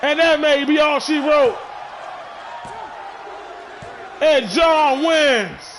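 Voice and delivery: excited finish call. Acted. Commentator calling the stunning winning cutter, excited shout. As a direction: sell the shock win, stretching the last words. Surprise finish, John wins.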